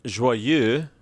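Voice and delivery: slowly modelled French pronunciation; French accent. Shown as a demonstration. Host showing co-host the YouTube pronunciation, French accent. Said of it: "According to the man on YouTube".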